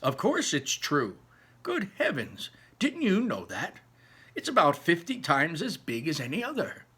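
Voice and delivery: lightly voiced old man, rising in disbelief; a hint of an amazed old grandpa; old grandparent. Grandparents telling Charlie of course it's true, lightly voiced amazement.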